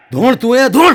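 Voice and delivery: high loud trance order; hammed-up possessed shout; mock shout. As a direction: bark it in a trance. Possessed woman orders policeman.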